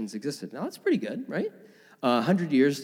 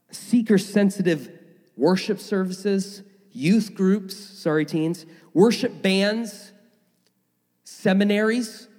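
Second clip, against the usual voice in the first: each item lifted higher, slowing on some; emphatic list; 6 semitones higher, slower than usual. Real, not acted. Preacher listing modern church comforts, pitched higher and emphatic.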